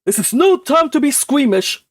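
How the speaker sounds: a brusque uncle demanding the truth; gruff uncle. Acted. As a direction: bark it out impatiently.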